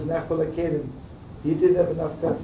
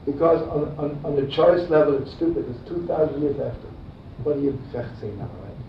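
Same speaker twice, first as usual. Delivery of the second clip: ordinary talk, nothing marked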